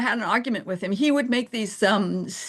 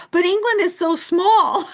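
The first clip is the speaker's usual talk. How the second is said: high-pitched, louder imitation of disbelief; mock naive protest; 10 semitones higher, 4 dB louder than usual. Americans insisting to an English newcomer, mock incredulous.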